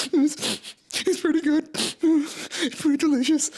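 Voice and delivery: put-on very high, sheepish little-boy voice; kid's inner voice. Boy tasting the meat in his head, high sheepish voice. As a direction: admit it like a guilty child enjoying it. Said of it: "so I'm like".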